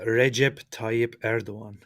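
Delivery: Recep Tayyip Erdogan said slowly, REH-jep TAH-yip EHR-do-an; slow Recep Tayyip Erdogan, c as j, silent g; official Turkish pronunciation. Shown as a demonstration. Co-host demonstrating the name, careful Turkish Recep Tayyip Erdogan. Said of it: "give us the official Turkish pronunciation".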